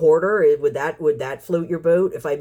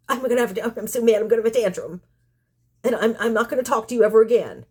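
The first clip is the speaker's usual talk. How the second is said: high, fast whiny toddler mimicry; mock them like a three-year-old in a tantrum; childish tantrum voice; 6 semitones higher, faster than usual. Vlogger mocks angry unsubscribers as toddlers, whiny and fast. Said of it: "three-year-old behavior".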